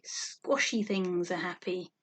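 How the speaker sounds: ordinary delivery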